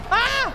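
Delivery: high loud shriek; startled scream. Real, not acted. Gamer attacked by creature, high loud shriek. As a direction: shriek as it lunges.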